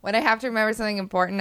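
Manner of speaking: ordinary talk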